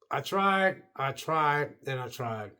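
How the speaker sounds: resigned repetition; tried thrice, fading. Retold or imitated. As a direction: say "I tried" three times, each one fading away. Retold giving up on movie.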